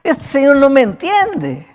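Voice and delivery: high, loud, urgent plea for his dying daughter; desperate father pleading. Acted. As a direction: beg like a frantic father who is being ignored. Jairo pleading in the story.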